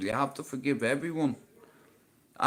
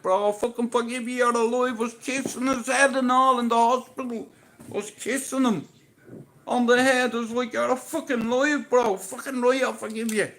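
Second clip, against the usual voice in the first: overjoyed; 8 semitones higher than usual. Retold or imitated. Retelling emotional hospital reunion.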